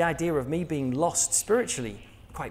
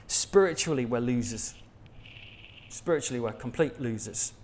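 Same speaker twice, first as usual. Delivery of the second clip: usual speaking voice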